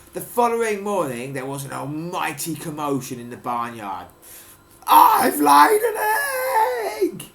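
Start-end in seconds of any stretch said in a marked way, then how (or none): 4.9-7.3 high, loud, drawn-out wailing shriek. Acted. Marjorie the cow shrieking to the barnyard, a wailing shriek. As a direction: shriek it out in a panic. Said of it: "shrieked Marjorie".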